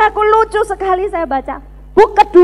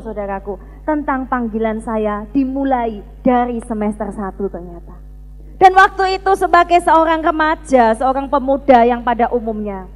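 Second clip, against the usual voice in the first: ordinary talk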